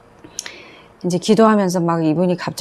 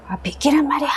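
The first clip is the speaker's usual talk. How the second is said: high thin whispered hiss of a possessed woman; thin sly demon voice; 9 semitones higher than usual. Retold or imitated. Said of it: "목소리가 막 가늘고".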